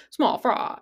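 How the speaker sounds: exaggerated copy of a strange rap voice; bizarre rapper voice. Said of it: "the voice is so fucking bizarre".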